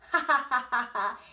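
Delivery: loud, showy chuckle; haughty laugh. Acted. Proud horse showing off to the meadow, haughty laugh. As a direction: laugh like a pleased show-off.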